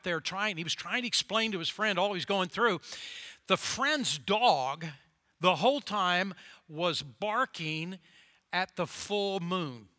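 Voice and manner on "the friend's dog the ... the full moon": slow, drawn-out storyteller beat; slow storytelling. Acted. Preacher telling his flock a story, slow and drawn out. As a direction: stretch it out to set the scene.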